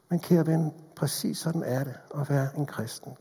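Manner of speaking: ordinary talk, nothing marked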